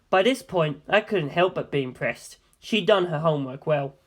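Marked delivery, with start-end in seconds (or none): none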